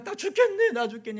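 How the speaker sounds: high, loud wail of despair; a mock lament. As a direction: cry out like the people are dying. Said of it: "이제 막".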